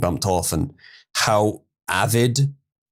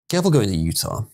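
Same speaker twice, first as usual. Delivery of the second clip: ordinary talk, nothing marked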